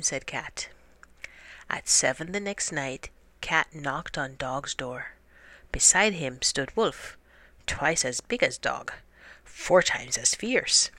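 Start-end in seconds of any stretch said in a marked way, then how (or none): none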